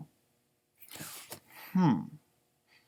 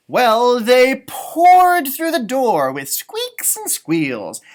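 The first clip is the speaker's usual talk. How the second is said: slow, louder read-aloud; storybook narration; 5 semitones higher, 7 dB louder, much slower than usual. A narrator's reading. Reading aloud to a child, slow and lively. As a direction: savour the squeaks and squeals.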